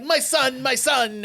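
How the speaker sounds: high-pitched panicking dad; mock panic. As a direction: act out a frantic father looking for his son. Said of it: "he's all panicking".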